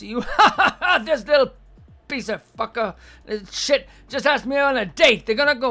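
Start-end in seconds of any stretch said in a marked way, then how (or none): 0.3-1.0 high, loud sneering laugh; mocking laugh. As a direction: laugh at him like a mean girl. Mocking girl laughing again.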